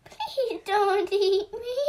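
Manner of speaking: high, loud squeaky plea; little goat pleading. Acted. Little goat begs the troll, high squeaky plea. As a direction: beg like a frightened tiny goat. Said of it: "cried the little Billy Goat".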